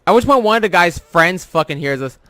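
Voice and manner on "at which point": ordinary talk, nothing marked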